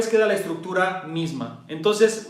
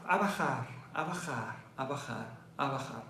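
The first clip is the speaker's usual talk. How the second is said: each repeat softer and lower; fading lower; 5 semitones lower, 13 dB quieter than usual. Shown as a demonstration. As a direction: lower the voice step by step. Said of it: "muestra cómo baja". (bajando la voz poco a poco)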